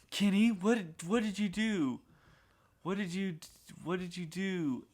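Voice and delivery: mother frantically asking her son what he did; alarmed mom. Retold or imitated.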